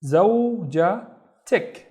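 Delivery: زوجتك very slowly, zow, ja, tik; syllable by syllable. Shown as a demonstration. Teacher building "your wife" for learners, زوجتك slowly split. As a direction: separate the three syllables of زوجتك. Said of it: "So it becomes".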